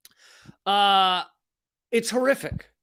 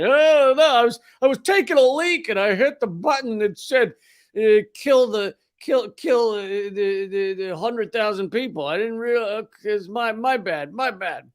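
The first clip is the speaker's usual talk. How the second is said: higher, louder goofy excuse that peters out; careless soldier excuse; 4 semitones higher, 3 dB louder than usual. Retold or imitated. Mocking a soldier who launched nukes by accident, goofy excuse. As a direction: play it like a dopey soldier shrugging off a disaster.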